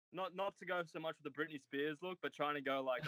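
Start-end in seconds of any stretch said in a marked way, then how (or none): none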